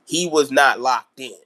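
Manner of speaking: usual speaking voice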